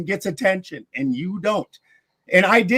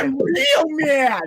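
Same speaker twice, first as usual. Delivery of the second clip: mock crying; 8 semitones higher, much slower than usual. Mocking the opponent again.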